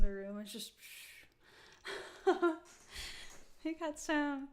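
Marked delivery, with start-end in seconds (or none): 1.8-3.6 quick unvoiced chuckle; little airy laugh at herself. Real, not acted. Laughs at darkening room.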